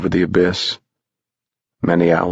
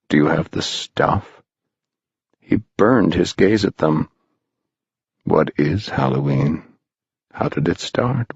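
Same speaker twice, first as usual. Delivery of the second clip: lower, hushed stranger's voice; eerie stranger; 3 semitones lower than usual. Acted. Stranger showing the boys the ravine, low and hushed. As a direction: draw their eyes to the dark. Moundshroud's long tempting speech.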